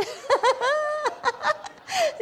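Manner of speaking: loud, high-pitched laughing fit; gleeful cackle; high giggle. Real, not acted. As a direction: crack up at your own cheeky line. Laughs at own spying joke.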